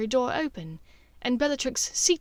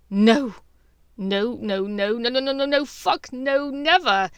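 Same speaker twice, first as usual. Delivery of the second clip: inner panic; 2 semitones higher, 5 dB louder than usual. Acted.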